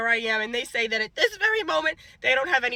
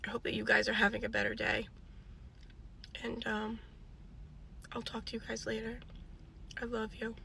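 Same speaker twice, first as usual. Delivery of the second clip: much softer, trailing away; soft sign-off; 2 semitones lower, 15 dB quieter than usual. Real, not acted. Saying goodbye to her viewers, softer and softer. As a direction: let it fade to almost nothing.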